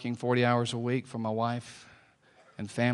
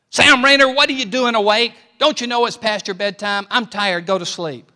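furious shout, much higher and louder, easing by the end; yelling at him; 10 semitones higher, 13 dB louder than usual. Retold or imitated. Tired father yells at his son for staying up, furious shouting. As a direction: blast it at the boy, then let it run down. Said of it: "I began to yell at him".

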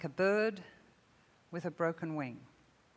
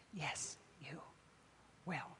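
whisper; 2 semitones lower, 15 dB quieter, slower than usual. Real, not acted. Quiet assurance to congregation.

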